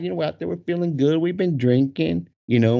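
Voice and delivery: usual speaking voice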